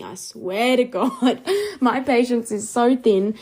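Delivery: laughing through a mock threat. Real, not acted. Joking to listeners about her patience, laughing. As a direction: laugh while you warn them off.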